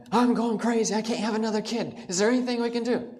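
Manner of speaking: a worn-out mom at the end of her rope; frantic mother. Retold or imitated. Mother dragging five screaming kids, frantic plea to doctor.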